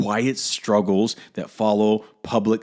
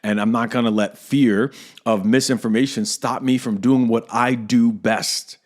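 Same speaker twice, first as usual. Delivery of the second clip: ordinary talk, nothing marked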